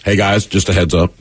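normal talking voice

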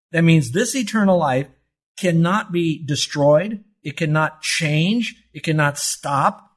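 fervent sermon climax; preaching at full force. Real, not acted. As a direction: drive each phrase home to the church.

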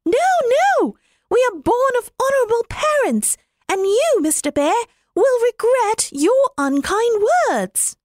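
tiny birds shouting back in outrage; indignant little wrens. Acted. Young wrens protest to the bear.

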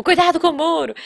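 imitated alarmed call-out; mock alarm. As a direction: warn like a panicking parent in the car. Parents' panicked warning, acted out.

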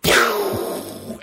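long airy explosion noise made with the mouth; voiceless whooshing "pow"; imitated splattering blast. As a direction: blow out a drawn-out burst for something exploding. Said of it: "I saw it just go".

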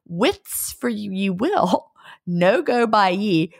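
slow, relished Scots phrasing; Scots dialect saying. Acted. Repeating the saying for her co-host, Scots dialect. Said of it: "I gotta say that again".

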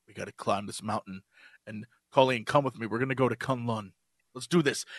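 low, deadpan mock hero voice; mock hero speech. Friends mocking a TV hero's pep talk, low deadpan. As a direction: parody a solemn hero.

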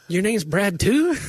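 high, drawn-out question; mock delighted surprise. Record executive greeting a bandmate, mock delighted surprise. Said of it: "And he goes".

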